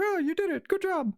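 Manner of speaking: very high, squeaky congratulations; impressed mouse. Acted. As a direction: squeak praise like a tiny mouse. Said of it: "The, the mouse is now staring".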